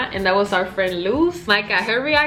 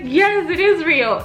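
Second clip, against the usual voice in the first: higher, slow emphatic yes; drawn-out, insistent confirmation, retold; insistent retold reply; 7 semitones higher, slower than usual. Telling the doubting friend it is real, emphatic.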